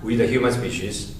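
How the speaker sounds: flat, low and soft; no rise on we. Shown as a demonstration.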